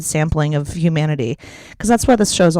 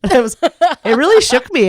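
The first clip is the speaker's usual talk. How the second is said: ordinary talk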